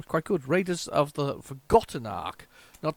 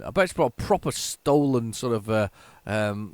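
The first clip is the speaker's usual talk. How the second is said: ordinary delivery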